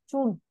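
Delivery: correcting the pupil's j sound: "Chun" with ch; ch sound demo. Shown as a demonstration. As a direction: say "Chun" clearly starting on ch. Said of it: "đều là chờ nhá".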